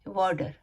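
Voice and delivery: वाटर said as "waa-der"; d in place of t. Shown as a demonstration. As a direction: soften the t of वाटर to a d. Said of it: "ढ का अल्फाज निकालते हैं".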